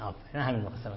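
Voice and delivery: normal talking voice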